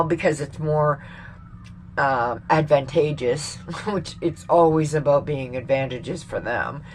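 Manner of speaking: usual speaking voice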